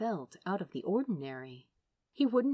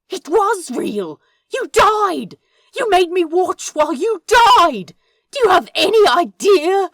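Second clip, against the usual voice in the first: much higher and louder, voice cracking; strangled shout; 12 semitones higher, 16 dB louder than usual. Acted. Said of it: "Sounded like a strangled cat".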